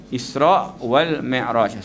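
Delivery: usual speaking voice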